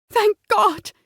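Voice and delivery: high sob of relief; tearful relief. Acted. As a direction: sob out thanks.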